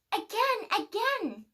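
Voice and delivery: put-on high, excited child squeal; a little pea begging to be flung again. Little Pea screams at Papa Pea to fling him again, excited squeal. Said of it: "Little Pea would scream".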